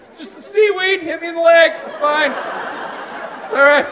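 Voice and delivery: high, flustered calling out; embarrassed reassurance. Retold or imitated. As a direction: call out sheepishly to the beach. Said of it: "It's like".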